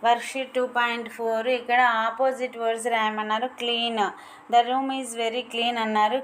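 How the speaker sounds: normal talking voice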